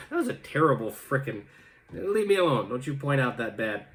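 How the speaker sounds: ordinary talk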